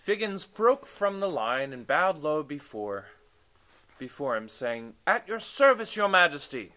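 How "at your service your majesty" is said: a bit higher, louder bow; first take. Acted. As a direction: try the reedy servant line. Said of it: "Okay, let me redo that".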